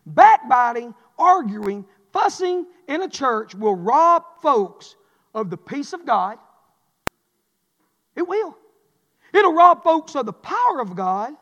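loud, slow, word by word; preacher warning the church against backbiting; hammering preaching. Acted. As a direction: pound each word at the church.

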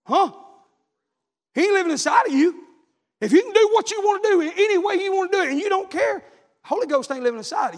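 high, loud preaching cry; preacher shouting the point home; preaching at full force. Acted. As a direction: let it ring out over the congregation.

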